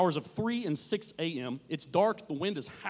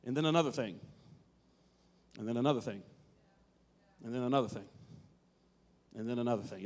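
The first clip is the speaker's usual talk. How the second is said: each repeat lower and softer; fading repetition; 7 semitones lower, 5 dB quieter, much faster than usual. Shown as a demonstration. Preacher to congregation, troubles piling on, fading lower each time. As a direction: let each one sink lower and fade. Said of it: "piling repetition, fading".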